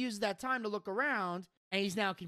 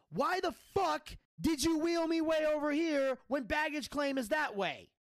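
raised, high-pitched mock outrage; angry retelling; 7 semitones higher than usual. Wrestler confronting the lady who wheeled him away, imitated anger. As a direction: act out his indignant demand, higher than usual. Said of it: "Buff is fucking pissed".

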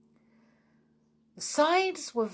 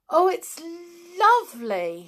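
high, loud cry of delight; delighted gasp; 11 semitones higher, 7 dB louder than usual. Acted. Anne looking down at the quarry full of primroses, delighted and high. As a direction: gush over the view.

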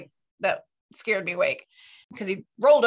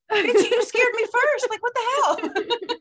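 indignant retort; 13 semitones higher, 5 dB louder than usual. Retold or imitated. Wife throwing the scare back at her husband, indignant. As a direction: drag it out in disbelief.

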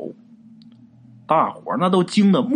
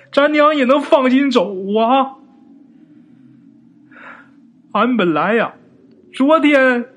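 tearful reproach; 6 semitones higher than usual. Acted. Brother tearfully reproaches Yinzi.

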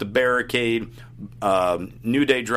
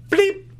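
high-pitched mock censor beep; imitated censor bleep; 4 dB louder than usual. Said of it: "they censor his microphone".

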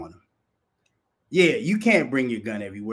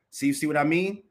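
ordinary talk, nothing marked

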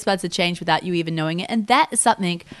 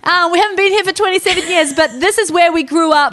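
much higher and louder, proclaiming to the shop; loud announcement; 9 semitones higher, 6 dB louder than usual. Retold or imitated. Family announcing their story entering every store, loud and high. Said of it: "like a whole announcement".

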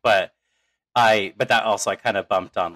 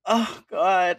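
high, mock-tearful exclamation; retold tearful cry; 11 semitones higher, 4 dB louder than usual. Viewer recalling tears at droid death, hammed-up tearful cry. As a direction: wail it lightly, half laughing at yourself. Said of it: "I was just crying".

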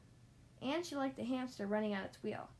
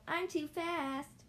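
high, stretched-out boast; mild storybook yell, drawn out; playful yell; 6 semitones higher, much slower than usual. A narrator's reading. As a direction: sing out the taunt slowly. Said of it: "she yelled".

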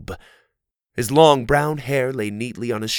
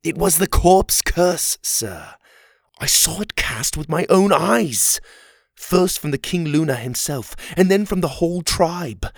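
higher, urgent eyewitness account; grave, pressing soldier's voice; earnest insistence; 7 semitones higher, 4 dB louder than usual. A narrator's reading. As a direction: insist on what you saw. Oliver earnestly testifies to the curse.

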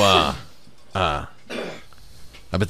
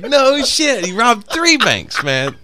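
higher, slowed awed exclamation; mock impressed; 13 semitones higher, slower than usual. Telling friends about the bank robber date, mock awe. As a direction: gush like he's a hero. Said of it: "I was like".

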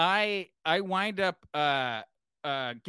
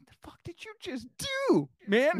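hissed under the breath, then voiced on man; stunned whisper; 9 semitones higher than usual. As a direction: whisper it in disbelief after being slapped. [name] reacts to the slap.

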